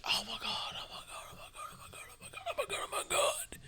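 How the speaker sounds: breathless whispered "oh my God" over and over; whispered panic. Retold or imitated. Ex-wife in disbelief at the dog, frantic whisper. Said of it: "she's like".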